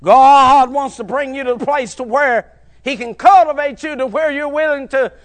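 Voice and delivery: loud, rising sermon cadence, punching each phrase; preaching at full force. Acted. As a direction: preach it to the back pews.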